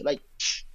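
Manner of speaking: a soft unvoiced shh; whispered shush. Shown as a demonstration. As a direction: hush someone with a quiet shh. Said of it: "when you tell people to shush".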